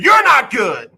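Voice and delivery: high, loud retort, hammed-up; mock angry shout back. Coach yelling back up at the heckler, mock angry. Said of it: "Bill O'Brien got mad and said".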